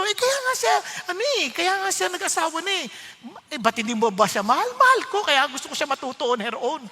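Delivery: husband arguing with his wife; insistent. Retold or imitated. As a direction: push the point home.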